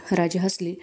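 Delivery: usual speaking voice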